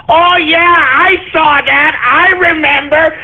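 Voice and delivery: high, dopey voice breaking into laughter; mock simpleton. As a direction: imitate a slow-witted viewer, cackling in recognition. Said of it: "your stupid, simplistic ass could go".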